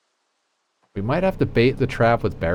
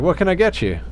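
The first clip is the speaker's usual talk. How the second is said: lightly raised, friendly waitress voice; 7 semitones higher than usual. Retold or imitated. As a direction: een vriendelijke serveerster, iets hoger. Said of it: "she's like".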